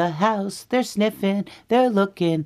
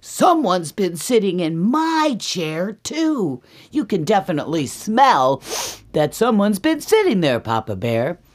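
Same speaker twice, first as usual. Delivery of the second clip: raised, emphatic, swooping complaint; indignant Mama Bear; 6 semitones higher, 5 dB louder than usual. Acted. Mama Bear tells Papa about her chair, indignant. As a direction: swoop up on the key words, affronted. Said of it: "And she goes".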